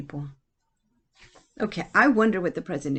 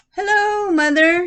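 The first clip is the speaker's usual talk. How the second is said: bright, much higher greeting, loud and happy; cheerful son; 12 semitones higher, 9 dB louder than usual. Acted. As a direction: call out hello like an eager grown son. Son Reuben greets Grandma.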